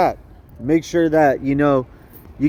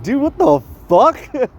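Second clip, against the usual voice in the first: drawn-out, higher exasperated question; incredulous; 6 semitones higher, slower than usual. Real, not acted. Speaker reacting to a stingy man's refusal, incredulous. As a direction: stretch it out in disbelief.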